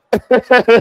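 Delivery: mocking laugh. Real, not acted. Laughs at commenter.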